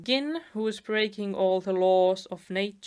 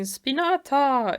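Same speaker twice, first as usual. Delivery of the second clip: slow, higher, louder attack cry; mock battle scream; 6 semitones higher, 5 dB louder, slower than usual. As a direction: shout it like an anime attack name. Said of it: "the wolf is screaming".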